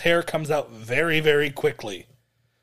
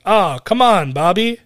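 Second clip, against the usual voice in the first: raised, louder voice with lazy swooping tune; mock half-hearted cheering; 5 semitones higher, 6 dB louder than usual. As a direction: imitate someone going through the motions of cheering. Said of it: "half-heartedly being like".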